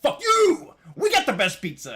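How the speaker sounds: screaming high and loud, outraged; furious hometown pride; angry shout. Retold or imitated. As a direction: yell it like a defensive local. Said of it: "And they're like".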